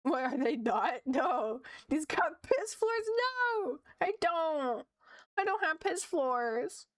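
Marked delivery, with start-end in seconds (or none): 1.1-6.9 indignant. Real, not acted. Denies her floors look dirty.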